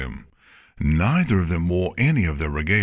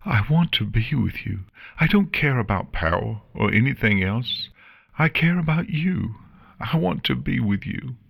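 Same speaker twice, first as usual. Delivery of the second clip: high, earnest pleading; understated desperate declaration; tearful plea; 7 semitones higher than usual. A narrator's reading. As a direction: plead through tears, gently acted. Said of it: "Silver cried out, tears flowing".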